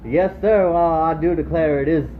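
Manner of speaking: Australian doing a Southern US accent; Southern American accent. Shown as a demonstration. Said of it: "I do a pretty mean Southern, uh, American accent".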